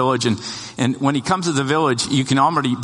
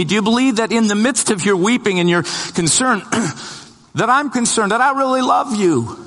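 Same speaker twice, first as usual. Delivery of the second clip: fervent preaching; 8 semitones higher than usual. Acted. The preacher urges the church to trust Jesus, fervent and high. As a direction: preach it hard, climbing to a plea.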